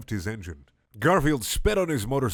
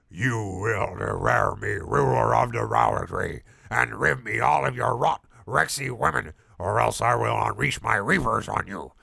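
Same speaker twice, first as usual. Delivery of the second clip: sinister snarl; 3 dB louder than usual. Acted. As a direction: snarl it like a cartoon monster. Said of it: "declared Odie with sinister snarl".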